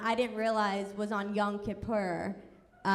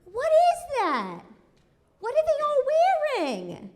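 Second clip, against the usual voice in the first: puzzled mom; 5 dB louder than usual. Retold or imitated. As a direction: play the clueless mom squinting at the crowd, pitched way up. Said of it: "she goes".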